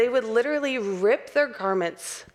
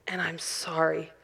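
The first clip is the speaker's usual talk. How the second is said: low, hushed, near-whispered sorry; heartfelt apology; 5 semitones lower, 6 dB quieter than usual. Acted. Believer repenting to God with open heart, hushed and sincere. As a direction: let the apology sink almost to a whisper. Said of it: "real repentance is not just feeling sorry".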